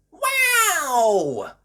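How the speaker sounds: high, loud drawn-out wonder; amazed gasp. A narrator's reading. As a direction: exclaim it high in sheer amazement. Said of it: "gasped Peppa".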